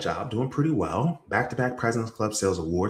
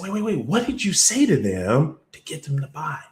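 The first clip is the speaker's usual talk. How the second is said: urgent surprise; 6 semitones higher than usual. Retold or imitated. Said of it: "And I said".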